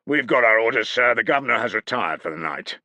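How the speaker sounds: smug refusal. A narrator's reading. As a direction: deliver it smugly. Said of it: "with more than a suggestion of smugness".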